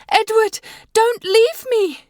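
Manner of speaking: high, loud, slow scream of pleading; screaming in terror. A narrator's reading. Terrified wife screaming for her husband to stay, high and loud. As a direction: scream after him as he goes. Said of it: "I scream out loud".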